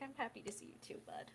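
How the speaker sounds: soft and hushed, hugging him close; tender hush. Acted. Octavia hugging her happy pet, soft and hushed. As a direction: murmur it into the hug. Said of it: "hugging him".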